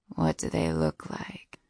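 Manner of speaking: low, slow breathy whisper. A narrator's reading. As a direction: lean in and whisper it. Late-night flirty phone call.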